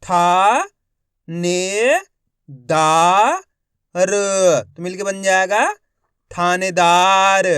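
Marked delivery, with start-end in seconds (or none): 0.0-4.1 each syllable called out loud and separate. Shown as a demonstration. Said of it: "एक एक अक्षर का उच्चारण करके". 6.3-7.5 थानेदार stretched out slowly as one word; slow blended reading of थानेदार. Shown as a demonstration. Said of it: "एक एक अक्षर का उच्चारण करके".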